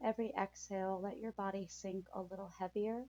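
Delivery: calm soothing guide through breathing; guided meditation. Acted.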